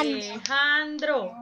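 Alejandro said slowly, beat by beat; syllables drawn out. Shown as a demonstration. Teacher to pupils, counting syllables slowly. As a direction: spell out Alejandro in slow claps. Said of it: "Cuántas tiene Alejandro".

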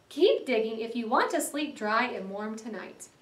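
ordinary delivery, nothing marked

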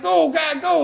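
rooting from the stands; excited cheer. Retold or imitated. Retold cheering at soccer.

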